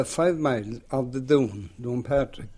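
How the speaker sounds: ordinary talk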